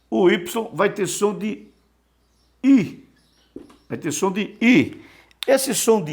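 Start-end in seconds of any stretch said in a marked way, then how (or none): 2.6-3.1 I said high and loud, halfway between i and u; German ü sound. Shown as a demonstration. Teacher showing students the Greek upsilon sound, high and loud. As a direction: round the lips on I, like German ü. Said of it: "É o som do I alemão".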